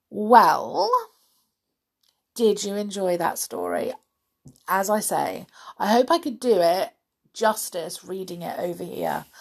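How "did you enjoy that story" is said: ordinary delivery, nothing marked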